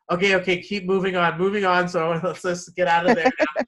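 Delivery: lightly acted impatient "keep it moving"; hurried, dismissive seven. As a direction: rush everyone out with a chuckle. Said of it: "the seven here is kinda like".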